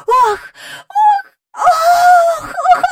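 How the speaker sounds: high, loud thrilled oh oh; excited cries. A narrator's reading. Jane crying out as she swoops over the willow. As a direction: cry out in delight while soaring. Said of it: "гукала Джейн".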